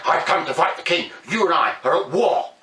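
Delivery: loud, fast defiant challenge. Acted. Blackbird defying the king, loud and quick. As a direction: stand up to the king.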